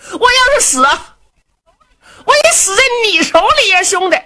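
high, loud wailing plea; desperate wail. Acted. Du Cha wails at Luo Cheng that he'll die, desperate. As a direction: cry out as if ready to die.

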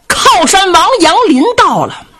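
high-pitched herald's announcement; announcing call. A narrator's reading. Herald to the court on the prince's arrival, high announcing call.

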